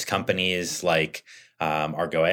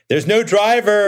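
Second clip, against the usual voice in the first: high, loud mock shout from a bystander; amused imitation of someone shouting; imitated yell; 8 dB louder than usual. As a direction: call it out like an onlooker yelling across the street.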